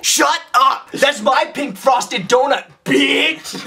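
high, hammed-up shouted outburst; mock diva shout, drawn out; YouTuber impression. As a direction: yell it in a high put-on voice. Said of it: "Can you do impressions of some YouTubers".

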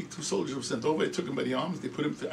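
ordinary talk, nothing marked

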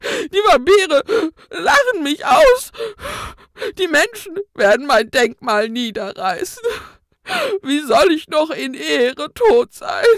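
high, loud, tearful ghostly lament; wailing ghost. A narrator's reading. Ghost hunter mourns his worthless tools, loud, high, sobbing. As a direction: wail your lost glory through sobs. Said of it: "zwischen zwei Schluchzern".

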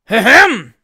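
huge, booming throat-clearing; very loud ahem. A narrator's reading. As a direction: blast out an "ahem" like a giant. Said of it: "These last sounds, so like those".

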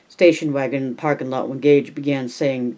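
ordinary delivery, nothing marked

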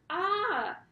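a high, loud, sudden yelp; children startled by the roaring fire, yelping. Acted. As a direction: jump back from the flames with a yelp. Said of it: "yelped Jack and Annie together".

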